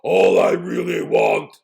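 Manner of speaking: deep, loud bear shout; roaring bear. Acted. Bear roars at Sheep from the cave, deep and loud. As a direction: roar it at Sheep. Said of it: "roared at Sheep".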